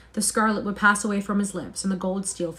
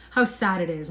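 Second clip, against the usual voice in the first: ordinary delivery, nothing marked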